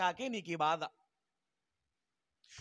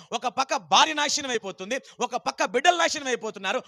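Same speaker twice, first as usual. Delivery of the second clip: louder, building preacher's rebuke; preaching rebuke; 4 dB louder than usual. Real, not acted. Preacher scolding believers over ruined families, loud rebuke. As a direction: scold the flock into responsibility.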